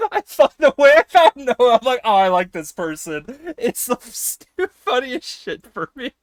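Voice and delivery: high voice breaking into laughter; laughing. Real, not acted. Streamer confessing how they found a friend, laughing through it. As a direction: talk through helpless laughter. Laughing while telling on self.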